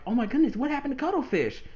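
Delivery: high, worried exclamation in the sisters' voice; alarmed gasp. Retold or imitated. The sisters react to the cut-off radio call, high and alarmed. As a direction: sound shocked and worried for a friend. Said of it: "So Callie and Marie are like".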